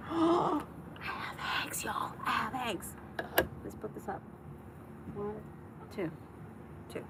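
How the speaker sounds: hushed, thrilled whisper; excited whisper. Real, not acted. Checking the coop on a live stream, excited whisper. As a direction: whisper the good news in pure delight.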